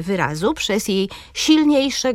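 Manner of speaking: ordinary delivery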